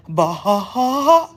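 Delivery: loud laughter breaking through Baja; laughing. Real, not acted. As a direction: cackle through the word Baja. Laughing at her own bit.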